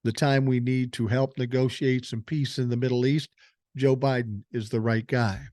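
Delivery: normal talking voice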